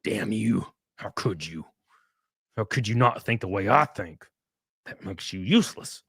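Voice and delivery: low, soft, contemptuous turn; mock bitter savior. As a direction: sulk at her for not agreeing. Savior turns on her.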